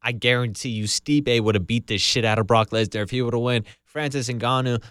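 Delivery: usual speaking voice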